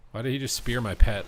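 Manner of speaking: outraged. Real, not acted. Pet speared, outraged.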